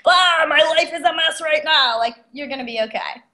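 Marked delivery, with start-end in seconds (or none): 0.0-2.0 hammed-up exasperated groan of a stuck listener; mock despair. Imitating a fed-up listener, hammed-up groan. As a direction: play a fed-up twenty-something, half-joking. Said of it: "somebody listening who's like".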